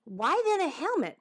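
high-pitched, quick interjected question; cutting in. Acted. Madison cutting in before an argument, high-pitched. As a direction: pipe up to head off an argument. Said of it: "Madison cut in".

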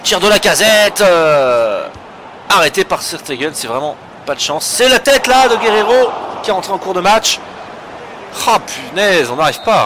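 loud, high frustrated match call; excited sports commentary. Real, not acted. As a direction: commentate the chance, then despair. Shot saved, header misses.

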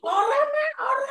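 very high-pitched acted daughter's denial; daughter answering mother. Daughter answers her mother on the phone, high acted denial. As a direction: pipe up high, playing the daughter. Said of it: "mae sing ning Indonesia telepon".

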